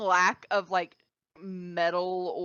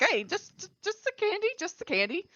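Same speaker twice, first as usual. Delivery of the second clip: startled doctor; 10 semitones higher than usual. Acted.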